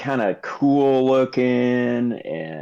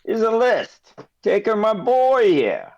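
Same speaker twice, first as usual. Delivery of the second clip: high, slow merchant voice; a pitched-up showy merchant; shopkeeper voice; 8 semitones higher, slower than usual. Acted. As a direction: hand over the list grandly. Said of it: "And he says".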